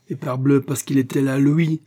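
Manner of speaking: plus fort et plus aigu, jaloux; éclat de colère. A narrator's reading. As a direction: cracher « lui » avec rancœur. (le mari jaloux crie contre Cornelius)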